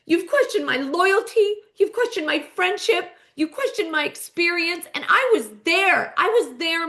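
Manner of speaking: high-pitched, impassioned mock screaming; tearful scream. Heather screaming back at Meredith in tears, distraught. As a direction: imitate an upset outburst, pitch held high. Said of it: "Heather screams back with tears running down".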